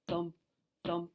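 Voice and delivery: ordinary delivery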